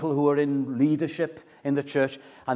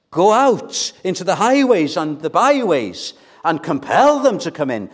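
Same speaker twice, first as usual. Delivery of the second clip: urgent, raised preacher's command; commanding master; 4 semitones higher, 7 dB louder than usual. Acted. Master to servant about the wedding guests, commanding. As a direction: send them out with insistent force. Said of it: "the master says".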